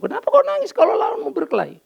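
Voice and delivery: high, lightly voiced stern father; father's voice. Father to crying son, lightly voiced stern question.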